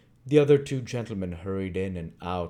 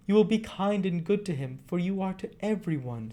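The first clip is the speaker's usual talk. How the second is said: put-on high boyish voice, eager; eager Oliver; 12 semitones higher, 3 dB louder than usual. Oliver answering Rose, high and eager. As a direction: jump in keenly as the boy.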